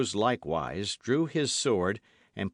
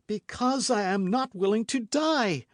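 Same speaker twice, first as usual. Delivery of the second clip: high, louder desperate plea; crying out; 12 semitones higher, 4 dB louder than usual. A narrator's reading. Milady crying out, struggling against her bonds. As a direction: beg for her life. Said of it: "cried Milady".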